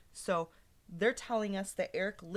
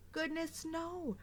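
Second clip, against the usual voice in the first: a bit higher, easy sing-song exclamation; lightly raised refrain; 8 semitones higher than usual. Acted. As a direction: shrug it off cheerfully. Pete's cheerful refrain.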